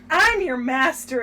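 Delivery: loud, slow mock assertion of rank; mock bossy. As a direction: pull rank, hammed up and drawn out. Acted master-servant bickering.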